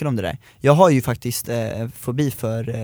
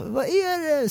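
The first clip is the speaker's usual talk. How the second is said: high, worried mom's call, much higher; imitating worried mom; 5 dB quieter, slower than usual. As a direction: call out like a startled mother. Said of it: "säger mamma".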